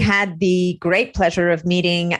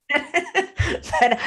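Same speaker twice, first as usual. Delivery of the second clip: spoken through a light laugh, slowly; a slow line broken by laughter; 8 semitones higher than usual. Real, not acted. Laughing while asking for story.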